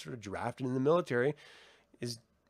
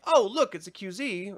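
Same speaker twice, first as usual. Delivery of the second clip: imitated excited discovery; 7 semitones higher, 3 dB louder than usual. Girl points out a place to her companion, imitated excitement. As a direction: play a mock "look over there". Retelling Ellie pointing something out.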